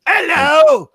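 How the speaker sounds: very high, loud strangled shout; Gumby voice. Acted. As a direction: yell it like Mister Gumby. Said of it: "I'll do the show as Mister Gumby".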